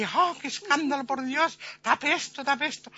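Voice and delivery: high, fussy mock outrage; scandalised ladies. Ladies demand the dancer be covered, high shocked voice. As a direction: plead for it to be covered.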